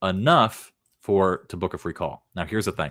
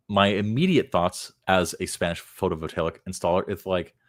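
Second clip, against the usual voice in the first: normal talking voice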